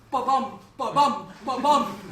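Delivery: thumping spoken heartbeat; heartbeat imitation. Performer voicing her beating heart, heartbeat imitation. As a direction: beat out each ba-bum, stress on the second beat.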